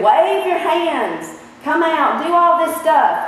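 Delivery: lightly hammed-up impatient Naaman; mock demanding Naaman. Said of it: "He said".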